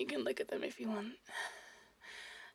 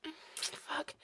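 usual speaking voice

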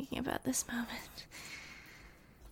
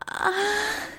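sudden high loud moan; loud pleasured moan; 9 semitones higher, 9 dB louder than usual. Acted. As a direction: let out a sharp cry of pleasure. Moaning during sex roleplay.

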